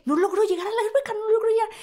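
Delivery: climbing high in panic; panicked thought. Retold or imitated. Running out of air, high panicked thought. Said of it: "Y yo así de".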